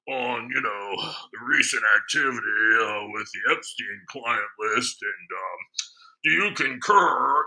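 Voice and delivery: hammed-up polite, fawning citizen voice; mock formal caller. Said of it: "So I went deep into character".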